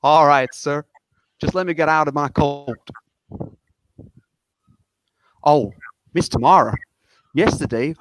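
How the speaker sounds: put-on Southern US accent. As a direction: explain yourself politely in a Southern US accent. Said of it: "try my best on this accent".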